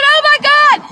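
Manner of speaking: very high, loud squealing; excited shriek. Retold or imitated. Seeing two lines on the test, excited shriek. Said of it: "I said".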